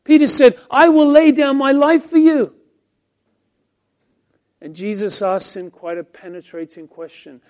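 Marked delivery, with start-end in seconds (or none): 0.7-2.5 loud, high, proud oath; Peter's bold vow. Acted. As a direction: swear it with loud certainty. Preacher voicing Peter's bold vow.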